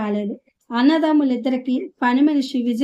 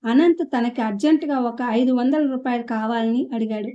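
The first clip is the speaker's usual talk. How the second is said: usual speaking voice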